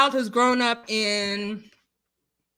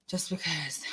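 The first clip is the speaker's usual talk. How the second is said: unvoiced whispered aside; 7 semitones lower, 11 dB quieter than usual. Real, not acted. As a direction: whisper it under your breath.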